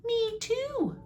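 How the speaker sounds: tiny, very high squeak; high-pitched storybook mouse; field mouse. Acted. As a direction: pipe up in a little mouse voice.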